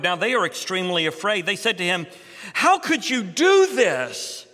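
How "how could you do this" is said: higher, slower, louder alarmed question; dismayed sailors. Acted. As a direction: demand it of him in disbelief, slowly. Sailors confront fleeing prophet.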